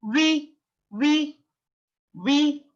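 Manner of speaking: V sounded as a high, long "vee"; V drawn out high for learners to copy; W plus long E. Shown as a demonstration. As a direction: model V with a held "ee" vowel. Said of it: "it sound like E".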